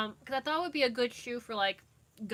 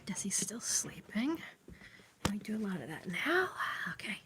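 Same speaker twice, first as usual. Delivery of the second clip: whisper; 3 dB quieter than usual. Real, not acted. Someone asleep nearby.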